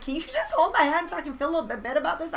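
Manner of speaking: high, fast, loud mock plea; imitated needy spouse, quick and high; pleading husband. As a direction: plead in a rush, pitched right up. Husband's plea acted in quarrel.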